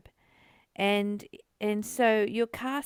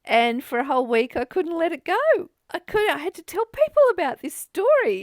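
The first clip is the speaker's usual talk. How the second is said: higher and louder, getting carried away; worked up; 7 semitones higher, 8 dB louder than usual. Real, not acted. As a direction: tell it like you're still indignant. Retelling hospital grievance, worked up.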